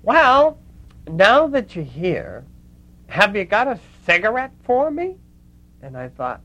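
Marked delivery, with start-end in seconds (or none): 0.0-5.2 loud, high Brooklyn lispy impression; Lena. Lena asks Mother Teresa for a cigarette, Brooklyn lispy voice. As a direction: imitate a brash Brooklyn woman, pitched up and loud. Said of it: "in this very thick Brooklyn lispy voice".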